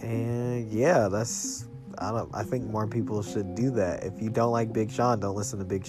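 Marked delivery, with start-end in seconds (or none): none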